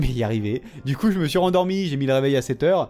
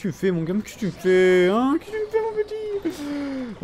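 mock scolding; 6 semitones higher than usual. Real, not acted. Scolding the enemy unit.

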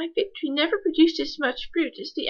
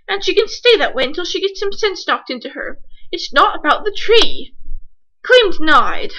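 angry rant; 4 semitones higher, 8 dB louder than usual. Acted. Ernie's rant ends in denial.

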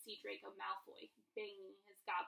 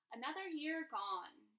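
loud, slow, cheery announcement; cheerful; 4 semitones higher, 7 dB louder, slower than usual. A narrator's reading. Headmaster opening his feast speech, cheerful. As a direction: open the speech brightly, drawing it out. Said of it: "Dumbledore said cheerfully".

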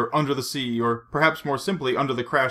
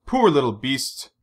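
ordinary delivery

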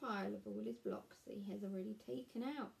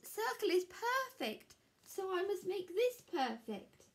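much higher, earnest determined voice; anxious Square; 10 semitones higher, 5 dB louder than usual. Acted. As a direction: insist on perfection up high.

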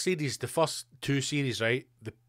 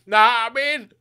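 hammed-up shouting punter; mock bellow; 10 semitones higher, 10 dB louder than usual. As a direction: bellow it, much higher and louder.

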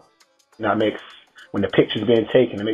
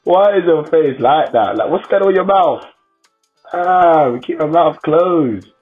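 shocked; 6 semitones higher, 5 dB louder, slower than usual. Real, not acted. Reacting to makeup video.

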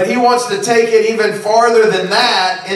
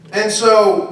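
ordinary delivery

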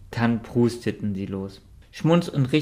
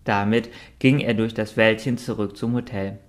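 usual speaking voice

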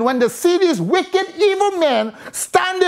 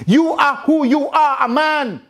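ordinary talk, nothing marked

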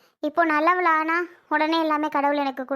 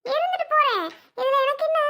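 "இருந்துட்டு போறேன்" pitched very high; heightened defiance; 9 semitones higher than usual. Acted. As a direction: fling it back in a much higher voice. Repeats the retort more forcefully.

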